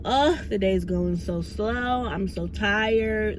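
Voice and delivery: very slow, dragging mock whine; sleepy drawn-out complaint; tired coworker. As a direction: imitate a worn-out coworker. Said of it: "is talking about".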